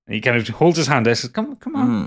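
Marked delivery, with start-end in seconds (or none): 1.3-2.1 very high character voice; high, airy put-on voice; brother calling down. Quattro holds out a hand, beckoning.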